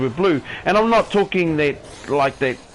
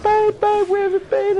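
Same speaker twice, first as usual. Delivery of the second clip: much higher, fretting call of a worried wife. Retold or imitated. Worried wife meeting her drunk husband at the door, high and anxious. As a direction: play the panicked partner pleading to know. Wife's frantic question, voiced.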